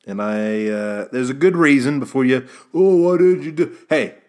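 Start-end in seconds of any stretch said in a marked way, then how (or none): 2.7-4.2 whiny raised imagined-listener voice, higher and louder; playful impression of a critical fan; mock listener demand. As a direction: throw in a nagging listener's accusing question. Imagined listener scolding the host.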